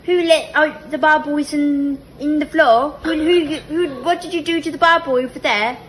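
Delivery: high, loud mock British. Shown as a demonstration. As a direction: pitch it up and scold in put-on British. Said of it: "make it a little bit higher".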